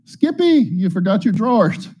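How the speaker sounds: high-pitched comic call after someone; mock calling out. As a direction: call after a runaway patient, playfully. Retold calling after a fleeing patient.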